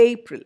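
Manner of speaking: A-pril, weight on the A of "April"; stress on first syllable. Shown as a demonstration. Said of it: "stress to the first syllable".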